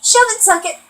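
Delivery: high, defiant "Shove it, suck it"; defiant retort. Retold or imitated. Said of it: "I said".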